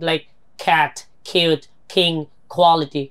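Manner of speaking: cat, cute, king with a strong puff of breath on the k; aspirated k. Shown as a demonstration. Teacher drilling the aspirated k sound for students. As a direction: push air hard through every k. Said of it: "খ sound".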